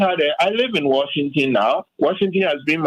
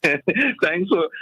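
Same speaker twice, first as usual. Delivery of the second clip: slow words broken by laughter; laughing through words; 3 semitones higher, much slower than usual. Real, not acted. Guest thanking the host while still laughing. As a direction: laugh as you try to say thanks.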